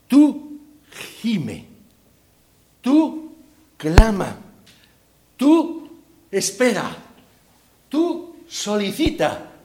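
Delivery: high, punched "Tú" before each command; emphatic preaching. Acted. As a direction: preach it word by word, rising up. Preacher listing the believer's part.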